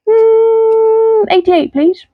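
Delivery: high puppet voice. Acted. Puppet choosing number.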